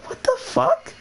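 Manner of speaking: shrill falsetto what the fuck; squeaky shocked. Retold or imitated. Reacting to his friend's gross confession, squeaky falsetto shock. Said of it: "And I was like".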